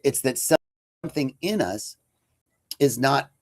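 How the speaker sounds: ordinary talk, nothing marked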